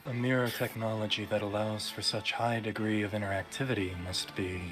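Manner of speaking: low, soft character voice; hushed low-pitched dramatic read; game character. Acted. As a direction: drop it low and quiet, measured. Reads game dialogue in character.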